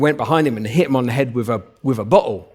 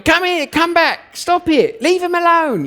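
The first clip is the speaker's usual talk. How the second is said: high, slow, frantic mock shouting; lady shouting after her dog, high and strained. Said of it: "the lady just kept shouting".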